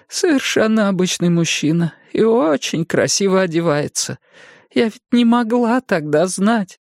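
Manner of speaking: высокий испуганный старческий голос; старушка в ужасе; взволнованная старушка. A narrator's reading. Jenny answers. (голос потрясённой старушки)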